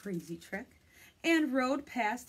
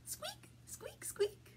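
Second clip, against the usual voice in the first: tiny, very high, whispery squeaks; mouse squeaks; 9 dB quieter, slower than usual. Acted. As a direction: squeak faintly like a little mouse. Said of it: "And mice say".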